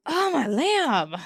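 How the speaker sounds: high-pitched, theatrical scolding; hammed-up scolding of a hopeless case; mock exasperation. Quotes a meme line before clip.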